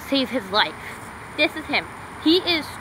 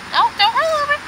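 much higher, louder scold; exasperated scold; 12 semitones higher, 4 dB louder than usual. Real, not acted. Owner scolding her rolling dog, high and exasperated. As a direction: squeal it at the dog.

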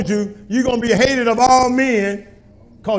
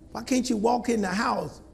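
ordinary talk, nothing marked

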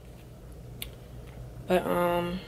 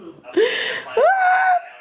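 high squealing laugh; 4 dB louder than usual. Real, not acted. Laughing at Christmas double.

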